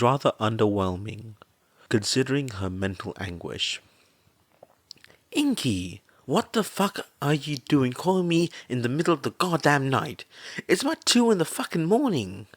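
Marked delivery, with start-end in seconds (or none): 5.3-12.5 groggy, irritated friend snapping, pitched higher; crude, sleepy tough-mom voice, raised pitch; gravelly slurred woman woken up. A narrator's reading. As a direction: woken at two in the morning and annoyed about it. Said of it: "Mitsu's gravelly and slurred voice".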